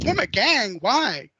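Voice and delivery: startled mother. Retold or imitated. Mother startled by gang idea.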